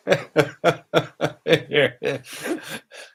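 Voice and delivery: high, rolling fit of giggles; helpless laughter. Real, not acted. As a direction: crack up at your own story. Cracks up telling yawning story.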